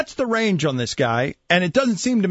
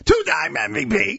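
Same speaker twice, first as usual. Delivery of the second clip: high, hammed-up exclamation in imitation; exaggerated, raised-pitch mimicry; mock pundit voice; 7 semitones higher than usual. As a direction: mimic someone crowing a player's credentials. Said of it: "it doesn't come close sounding like that".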